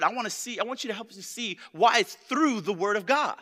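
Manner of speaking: ordinary talk, nothing marked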